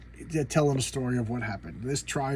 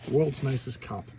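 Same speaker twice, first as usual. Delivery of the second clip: ordinary talk, nothing marked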